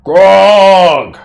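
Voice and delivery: long, loud, high battle cry; hobgoblin yell. Acted. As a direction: bellow it out as a drawn-out alarm call. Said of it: "he just kinda yells out".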